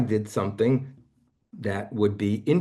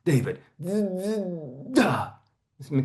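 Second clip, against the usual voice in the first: demo stutter; 8 semitones higher, much slower than usual. Shown as a demonstration. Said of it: "And then it goes".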